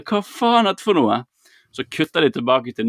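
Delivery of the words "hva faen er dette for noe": higher, aghast question; stunned disbelief. Retold or imitated. Gaping viewer asking what just happened, aghast. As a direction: ask it like you can't believe your eyes.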